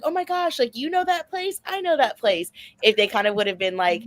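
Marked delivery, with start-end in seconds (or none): 0.0-2.5 high, bright gushing recognition; squealing oh my gosh, spotting a familiar place; excited gush. Pretend. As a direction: gush like a thrilled fan. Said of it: "kind of just being like".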